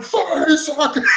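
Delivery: high, drawn-out mock yell; Jason Statham yell. Film fan answering "how much time is there", imitated yell. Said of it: "where Jason Statham yells".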